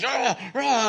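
high mock battle cry; sleepwalker growl. Sleepwalking coworker roaring again, high mock battle cry. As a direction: roar it out half-asleep. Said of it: "And he's".

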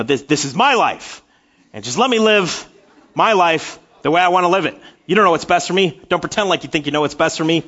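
mock toddler rant, loud and quick; imagined defiant toddler. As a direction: voice the stubborn kid, tongue in cheek. Child's imagined defiant speech.

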